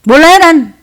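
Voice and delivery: high, loud acted protest; emphatic playacted denial; sworn denial. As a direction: deny it loudly and flatly refuse. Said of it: "맹세했더니".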